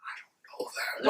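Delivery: hushed, voiceless retold disclaimer; whispered denial. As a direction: whisper it to disown her. Retold denial of knowing the girl.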